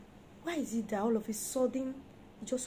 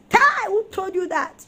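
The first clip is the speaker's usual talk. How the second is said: loud, high rebuke to her son; scolding son; 7 semitones higher, 11 dB louder than usual. Retold or imitated. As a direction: scold Tai sharply. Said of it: "I say".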